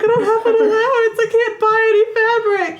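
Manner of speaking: very high, hammed-up teenage whine; whiny pleading daughter. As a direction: plead in a squeaky mock-whine. Voices daughter pleading with her mother.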